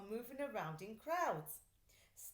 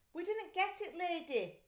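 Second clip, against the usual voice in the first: higher, sheepish policeman voice; apologetic policemen; 8 semitones higher, 4 dB louder than usual. A narrator's reading. Policemen apologising to the mother, higher. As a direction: own up meekly. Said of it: "they said".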